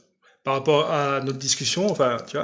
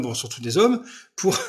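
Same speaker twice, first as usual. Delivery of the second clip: ordinary talk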